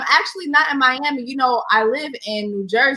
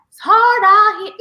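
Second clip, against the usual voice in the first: louder, higher mock lament; mock wail; 10 semitones higher, 6 dB louder than usual. Real, not acted. Guest lamenting quarantine alone, mock wailing. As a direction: cry out the complaint. Complaining about lonely quarantine.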